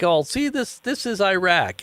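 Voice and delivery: high, drawn-out presenter voice; mock presenter. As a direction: play the proud tech CEO. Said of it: "he's like".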